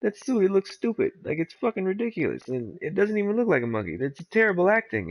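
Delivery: high mocking rant; mock ripping on him. Rock ripping on the ape suit, high mocking rant. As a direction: trash the suit with glee. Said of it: "He's like".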